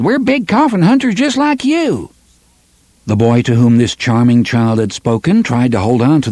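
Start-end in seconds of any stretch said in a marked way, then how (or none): 0.0-2.1 high, mocking child's call; taunting boy. Boy taunting Jonas across the street, high and mocking. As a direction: jeer across the street at him. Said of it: "and called".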